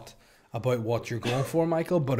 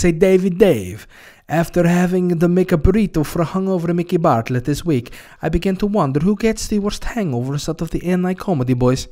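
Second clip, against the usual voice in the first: louder, higher, hammy Russian voice; mock Russian accent; 7 semitones higher, 10 dB louder than usual. Host reading listener question in mock Russian. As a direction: read it in a put-on Russian accent. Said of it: "I don't know why I'm doing Russian".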